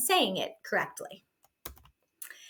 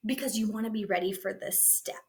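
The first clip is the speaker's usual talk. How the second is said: whispered aside; 5 dB quieter, faster than usual. Real, not acted. Aside to teachers mid-lesson.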